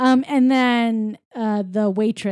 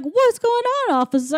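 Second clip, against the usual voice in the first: high, lilting mock-innocent question; man posing as lady; 12 semitones higher, 3 dB louder than usual. Pee-wee in disguise to the officer, mock-innocent lady. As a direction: play a man badly passing as a lady. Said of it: "He doesn't change his voice".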